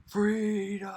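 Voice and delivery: high, loud battle cry of freedom; yelled cry. Retold or imitated. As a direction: throw it out like a dying hero's last shout. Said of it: "and he yells out".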